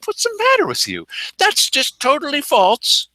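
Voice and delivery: high, incredulous mock indignation; sarcastic echo of an exasperated official; mock outrage. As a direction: throw the question back at them in disbelief. Mocking the official's claim.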